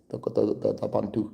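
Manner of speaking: quiet, put-on voice mocking how they talk; mocking imitation. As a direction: softly parrot the chatter. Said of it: "someone that talks like this".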